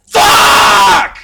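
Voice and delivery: a full-throated scream of real frustration; frustrated scream. Real, not acted. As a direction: scream it out at the bad luck. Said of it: "I shouldn't have screamed".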